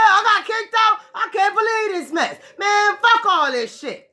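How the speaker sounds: high, loud, furious devil ranting; high-pitched outraged devil cursing his luck; mad devil. Acted. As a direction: rant as the kicked-out devil, voice pushed way up. Devil raging after expulsion.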